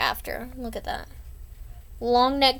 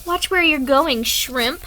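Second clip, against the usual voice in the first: tough bully; 4 semitones higher, 6 dB louder, slower than usual. Acted. Bully voiced in own comic.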